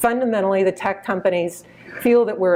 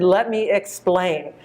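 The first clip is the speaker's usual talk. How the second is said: Texas drawl; slower than usual. Retold or imitated. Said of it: "he said in a nice Texas drawl".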